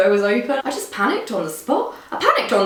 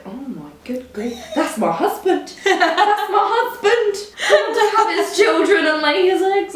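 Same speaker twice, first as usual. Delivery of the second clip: mock swooning; 8 semitones higher, slower than usual. Smitten guest spots a French model, building to giddy squeal. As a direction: start hushed, climb into giddy declaration. Acted instant crush on a model.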